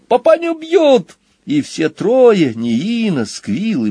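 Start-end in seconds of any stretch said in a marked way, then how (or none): 0.1-1.2 very high, drawn-out squeal; Squill's squeal. A narrator's reading. Son sees dad being beaten, squealing. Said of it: "взвизгнул Сквил".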